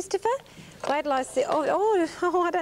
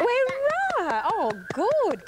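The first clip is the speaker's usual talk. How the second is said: delighted; 7 semitones higher, much slower than usual. Real, not acted. Answer revealed correct.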